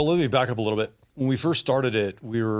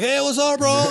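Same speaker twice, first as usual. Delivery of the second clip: higher, breezy and pleased with himself; cocky greeting; 5 dB louder than usual. Retold or imitated. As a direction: greet him like nothing happened. Casual greeting after landing.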